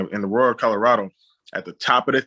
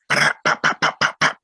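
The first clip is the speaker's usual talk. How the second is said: louder sharp mouth pops for gunfire; a quick run of louder mock gunshot pops; imitated gunshots; 5 semitones lower, 4 dB louder than usual. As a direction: pop each shot out like distant gunfire. Imitating gunfire in theater story.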